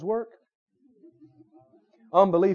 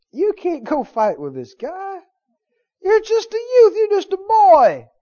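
high, loud protest as an alarmed king; incredulous objection; 13 semitones higher, 9 dB louder than usual. Acted. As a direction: push the pitch way up in disbelief. Acting Saul objecting to David.

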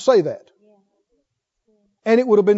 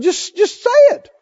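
high, loud, insistent tempter; goading devil; 10 semitones higher, 7 dB louder than usual. Retold or imitated. Devil tempting Jesus to speak, high insistent goading. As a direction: keep needling, louder and higher, pushing them to give in. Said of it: "And the devil said".

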